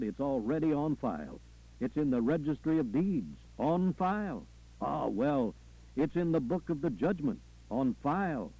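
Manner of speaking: fervent Southern preacher with chanted refrains; preacher, insistent. Acted. Preacher telling his flock the record is on file, insistent. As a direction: drive the refrain home, punctuated by interjections.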